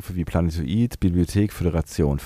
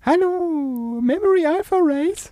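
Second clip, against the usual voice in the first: Heliumstimme; slower than usual. Pretend. Parodiert Heliumstimme des Partners.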